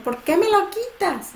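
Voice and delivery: put-on high voice of a child whose toy gets snatched; hurt child. Child protesting to parent who grabbed toy, high wounded voice.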